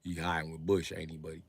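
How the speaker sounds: ordinary talk, nothing marked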